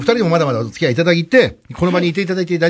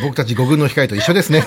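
ordinary delivery

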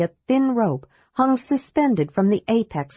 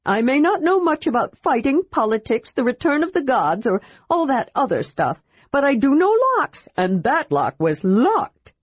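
higher, proud kender protest; 7 semitones higher than usual. Acted. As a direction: defend his lock expertise. Tas proudly defending.

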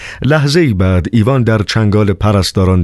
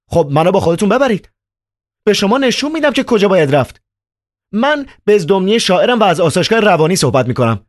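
put-on much higher, agitated young man; Ivan's voice. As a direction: play Ivan, high and worked up. Ivan on the phone.

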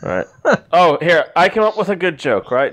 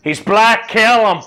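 punchline barked, higher and a bit louder; mock shout; 7 semitones higher, 5 dB louder, slower than usual. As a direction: yell the joke's last line as the robed man. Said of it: "then looks at the other two and says".

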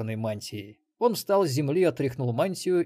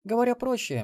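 high female voice, grim remark; Мелисса, gloomy; 12 semitones higher, 4 dB louder than usual. A narrator's reading. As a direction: put it grimly in a high woman's voice. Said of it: "мрачно заметила Мелисса". (мрачное замечание высоким женским голосом)

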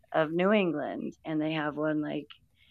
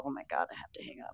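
quick breathless whisper; retold whisper; 4 semitones lower, 11 dB quieter, much faster than usual. Retelling realizing it's late on a call, quick whisper. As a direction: hiss it fast under your breath. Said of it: "and I go".

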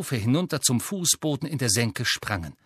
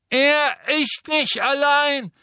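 put-on much higher, louder, slow boyish call; Neville calling out; 13 semitones higher, 5 dB louder, slower than usual. A narrator's reading. Neville calling down to Harry, brave boyish cry. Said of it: "rief eine Stimme von oben her".

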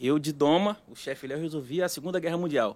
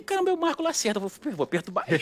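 excited; 7 semitones higher than usual. Retold or imitated. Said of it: "Eu falei".

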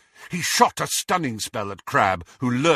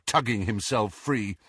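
plain reading voice

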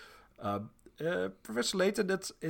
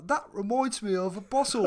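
high, louder put-on British kid accent for "That reminds me of a puzzle."; imitating Luke; 8 semitones higher, 5 dB louder than usual. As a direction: chirp it like an eager British schoolboy. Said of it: "and Luke will say".

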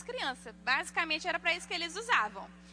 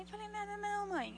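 put-on higher, softer little-girl protest; child's denial; 6 semitones higher, 6 dB quieter than usual. Daughter denying it to her mother, meek child voice. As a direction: imitate a child pleading innocence.

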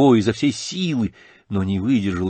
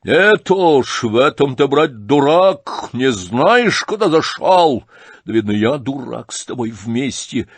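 raised, rough and reckless; loud outburst; 4 semitones higher, 6 dB louder, slower than usual. Acted. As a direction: blurt it out loudly. Rogozhin bursting out in a quarrel.

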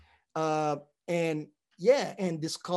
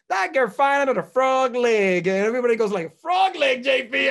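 put-on Southern accent; 8 semitones higher, 6 dB louder than usual. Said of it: "fucked it up 'cause I thought".

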